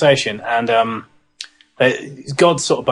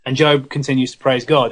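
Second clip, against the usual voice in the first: ordinary talk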